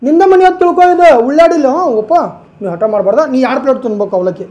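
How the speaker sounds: loud, overdone coaxing; booming mock-loving fuss; feigned affection. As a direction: pretend to pamper him, at full volume. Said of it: "Preethi ಮಾಡತ್ತಿದ್ದೀವಿ".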